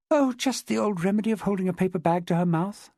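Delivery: lightly dismissive woman's reply; a touch of brush-off. A narrator's reading. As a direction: wave the matter away. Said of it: "said Jessica dismissively".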